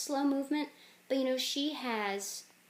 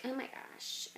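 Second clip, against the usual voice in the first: put-on hushed teen exclamation; angsty teenager; 3 semitones higher, 8 dB quieter than usual. Angsty teenager reacting, hushed put-on voice. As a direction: say it softly, as a mock teenager. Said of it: "the angsty teenager".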